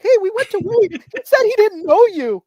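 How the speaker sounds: imitated kid complaining, very high and loud; high, loud mock tattling; upset kid brother. Brother reports being disowned.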